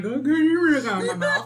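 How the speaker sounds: laughing through a fake order; laughing mock demand. Telling her partner to pull out, laughing.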